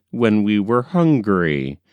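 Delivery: usual speaking voice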